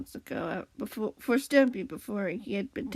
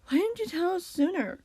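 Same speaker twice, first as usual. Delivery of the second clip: high-pitched question, more gentle than stern; firm but gentle; 8 semitones higher than usual. A narrator's reading. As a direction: ask firmly without scolding. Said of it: "Squid asked in his firm tone, but it felt more gentle than stern".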